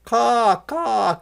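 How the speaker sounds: high loud caw; crow cawing. A narrator's reading.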